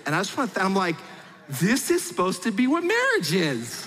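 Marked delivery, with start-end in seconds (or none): 1.5-3.8 overjoyed exclamation. Retold or imitated. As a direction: act out a blissfully amazed husband, stretching it high. Acts out overjoyed husband.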